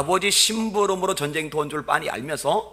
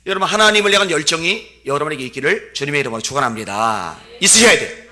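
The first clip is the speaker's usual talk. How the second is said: louder, solemn benediction; preaching blessing; 3 semitones higher, 11 dB louder than usual. Acted. Pastor blessing the congregation, loud and solemn. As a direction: pronounce the blessing with force.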